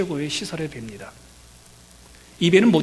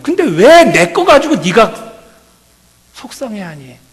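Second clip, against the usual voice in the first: loud, high preaching cry; preaching at full force; 7 semitones higher, 11 dB louder, slower than usual. Acted. Pastor urging the congregation to come, loud preaching.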